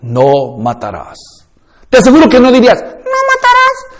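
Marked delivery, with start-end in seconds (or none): 0.0-1.2 low, slow and grave, like God speaking; solemn divine command, slow and low; deep authoritative voice. Shown as a demonstration. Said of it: "¿qué tono le pondrías?". 2.8-3.9 very high falsetto, mocking; ridiculous squeaky voice. Shown as a demonstration. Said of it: "eso es ridículo".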